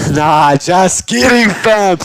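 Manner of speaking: loud, high, joking outburst; laughing prank reveal. Retold or imitated. Naruto dropping his fight with Orochimaru, laughing reveal. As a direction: burst out with it, laughing.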